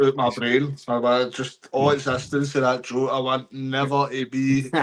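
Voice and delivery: loud, emphatic comic disgust; hammed-up disgust at a terrible joke; mock outrage. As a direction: declare it loudly, dragging out the last words.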